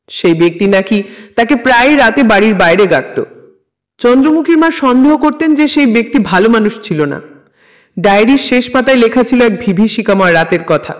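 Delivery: usual speaking voice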